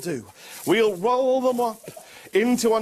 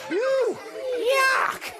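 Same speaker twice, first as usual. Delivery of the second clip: very high, hammed-up squeal of disgust; mock yuck. Magician reacts to the bone for the children, squealed mock disgust. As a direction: squeal like it's gross, for laughs.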